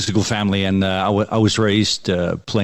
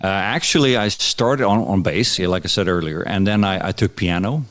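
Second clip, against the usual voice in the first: ordinary talk, nothing marked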